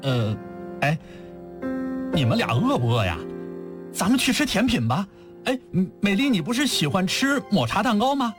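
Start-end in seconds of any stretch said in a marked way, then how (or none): 2.1-8.4 put-on higher-pitched boy rallying friends; lively child's invitation; speaking up eagerly. A narrator's reading. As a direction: brightly propose a treat to cheer a friend. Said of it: "大声说".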